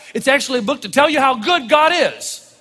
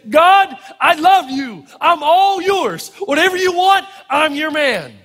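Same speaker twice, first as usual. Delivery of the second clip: higher, slow, fervent declaration; passionate devotion; 5 semitones higher, slower than usual. Retold or imitated. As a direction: pour your heart out to God. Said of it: "And he said".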